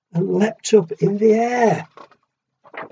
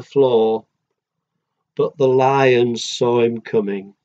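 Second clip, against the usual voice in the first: gentle rhythmic read-aloud; storybook reading. A narrator's reading. Parent reading a picture book to a child, sing-song rhyme.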